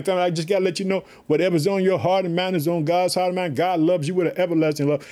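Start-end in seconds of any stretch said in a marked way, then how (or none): none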